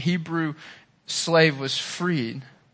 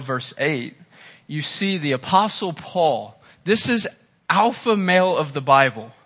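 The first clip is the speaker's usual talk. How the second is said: normal talking voice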